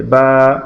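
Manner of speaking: long, held "বা"; drawn-out "বা". Shown as a demonstration. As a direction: linger on the vowel of "বা". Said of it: "আমরা একটু টেনে পড়ব". (টেনে "বা")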